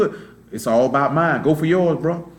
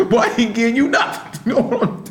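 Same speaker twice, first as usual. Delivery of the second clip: laughing through his own retold line; chuckling while quoting himself; 6 semitones higher, slower than usual. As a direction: laugh the words out, higher.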